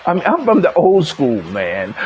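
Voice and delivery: usual speaking voice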